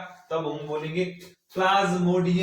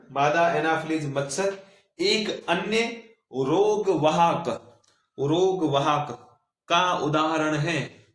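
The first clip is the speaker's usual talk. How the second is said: dictating; 4 dB louder, slower than usual. Shown as a demonstration. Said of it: "लिखो".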